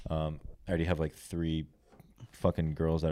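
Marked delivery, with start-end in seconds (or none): none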